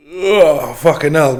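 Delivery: sudden exclamation. Real, not acted. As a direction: blurt it out as something goes wrong. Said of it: "sudden exclamation".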